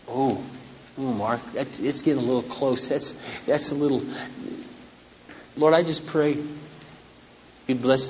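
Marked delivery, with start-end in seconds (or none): 0.1-4.0 reluctant mutter. Retold or imitated. Voiced listener's reluctant reaction.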